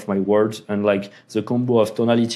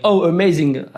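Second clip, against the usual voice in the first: mock cheerful reply; 7 semitones higher, 4 dB louder than usual. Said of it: "I was like".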